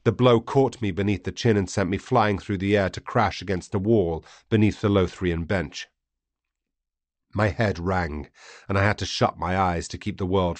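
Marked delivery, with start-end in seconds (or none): none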